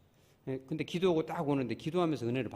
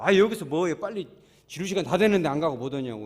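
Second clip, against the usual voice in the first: loud, higher nagging of a passerby; mock scolding; 4 semitones higher, 10 dB louder than usual. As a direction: imitate a woman telling someone off, loudly. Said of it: "막 뭐라고 하더라고".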